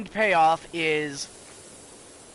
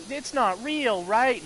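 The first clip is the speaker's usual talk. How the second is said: put-on anxious kid; 6 semitones higher, slower than usual. Kid seeking reassurance in story.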